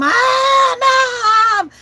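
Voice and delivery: loud, high spoiled-brat whine; whiny kid yell. Retold or imitated. Little girl whining loudly to her mother. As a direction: yell for mom like a spoiled child.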